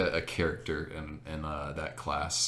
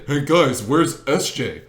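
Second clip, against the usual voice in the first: mock call-out; 7 semitones higher, 11 dB louder, slower than usual. Kid calling out to group.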